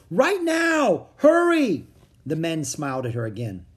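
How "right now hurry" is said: high, loud warning yell; urgent yell. A narrator's reading. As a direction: yell an order to everyone in the room. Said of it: "Annie yelled at the card players".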